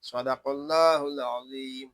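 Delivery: slow, drawn-out melodic tajweed recitation; teacher reciting the verses after explaining them, slow chant; Quran recitation. Acted. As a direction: chant each verse slowly, stretching the vowels.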